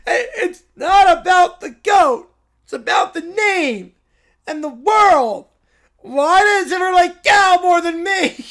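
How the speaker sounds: put-on high, loud, tearful child shout; crying bratty kid. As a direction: wail it like a jealous little boy. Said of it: "Cow says".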